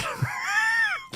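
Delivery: high, shrieking laugh at own joke; real high cackle breaking out; burst of laughter. Real, not acted. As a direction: crack up with a squealing laugh. Cracking up at own impression.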